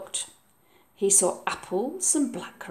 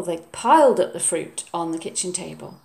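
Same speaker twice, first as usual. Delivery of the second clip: usual speaking voice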